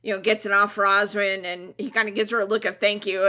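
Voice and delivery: ordinary talk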